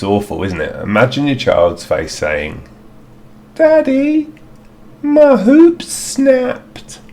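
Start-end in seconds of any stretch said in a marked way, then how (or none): 3.5-7.0 put-on very high, loud, squeaky little kid; hammed-up kid voice, drawing out the words; silly child voice. Child telling Daddy the hoop snapped, in a silly high voice. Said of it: "not in that silly voice anyway".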